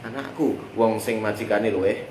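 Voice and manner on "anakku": usual speaking voice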